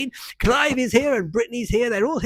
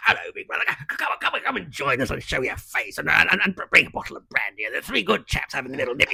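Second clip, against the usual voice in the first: put-on old boy voice. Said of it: "we talked like that, both of us".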